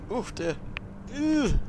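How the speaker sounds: ordinary talk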